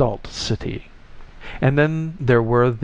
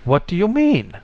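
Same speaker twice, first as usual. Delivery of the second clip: higher-pitched indignant question; affronted, raised-pitch demand; 7 semitones higher than usual. A narrator's reading. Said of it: "the indignant flesh attached to the tie demanded".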